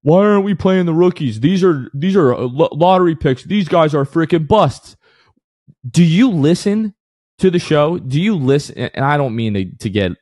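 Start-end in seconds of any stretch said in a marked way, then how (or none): 0.0-5.0 mocking whiny fans. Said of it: "to the people out there that are saying". 5.9-8.8 emotional outburst. Real, not acted. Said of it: "I'm getting a little too emotional here".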